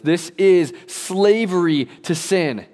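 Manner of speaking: preacher pronouncing sin's slavery; slow emphatic declaration. Real, not acted. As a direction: hammer each word slowly.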